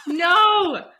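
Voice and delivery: a high, loud, laughing "No!" in disbelief; laughing shocked no. Real, not acted. Reacting to the broken ankle news.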